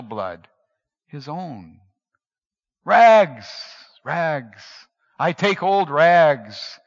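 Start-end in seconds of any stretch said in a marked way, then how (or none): 2.8-6.8 loud peddler's cry, slowing on the last words; the bleeding ragman crying his trade. Acted. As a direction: call the wares out though hurt. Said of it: "cried the sobbing, bleeding".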